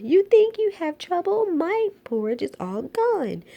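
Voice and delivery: put-on high, loud baby-bear wail. A narrator's reading. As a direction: wail it like a little cub who lost his porridge. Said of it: "Baby Bear wailed".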